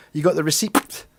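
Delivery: "receipt" with the silent P sounded; pronounced P. Shown as a demonstration. Copying Ricky Fulton for fun, "receipt" said with a P. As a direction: pop an audible P before the T in "receipt". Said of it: "I would always pronounce the P".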